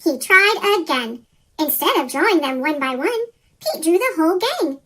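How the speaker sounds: ordinary delivery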